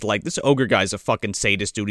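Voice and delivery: normal talking voice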